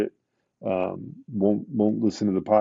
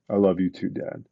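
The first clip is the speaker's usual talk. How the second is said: understated, grave imitation of a son; sobered retold child. Son answers his hurt dad's plea, suddenly sobered. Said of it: "it was almost like he aged twenty years".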